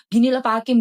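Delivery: ordinary talk, nothing marked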